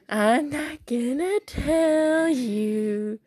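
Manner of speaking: slow teasing singsong. Real, not acted. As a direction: drag it out playfully. Teasing the viewers.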